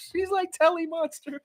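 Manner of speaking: high squeaky laughing voice; real helpless laughter; cracking up. Real, not acted. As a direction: squeeze the words out through laughter. Cracking up at own comparison.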